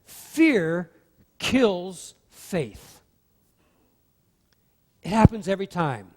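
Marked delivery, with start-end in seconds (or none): 0.0-3.0 Fear and kills punched, slowly; emphatic repeat. Shown as a demonstration. Preacher repeating his key line to the congregation, emphatic. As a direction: lean hard on "Fear" then "kills faith". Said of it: "Let me say that again".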